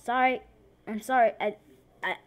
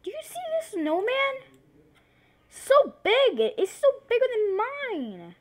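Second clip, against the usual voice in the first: much higher, fast, cartoonish voice; playful squeaky voice; 12 semitones higher, 5 dB louder than usual. Gamer showing off a giant snowman, squeaky excited voice.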